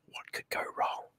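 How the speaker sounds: whispered, dripping with sarcasm; a sly sarcastic whisper to the viewers. Real, not acted. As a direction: drop to a mocking whisper for the punchline. Sarcastic aside on prison policy.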